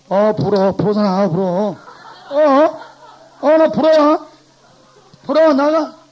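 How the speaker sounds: loud, high barking gate guard; gatekeeper. Acted. Teacher plays a gatekeeper turning away French speakers: loud barking guard. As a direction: bark at each visitor like a stern gatekeeper. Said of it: "그래서".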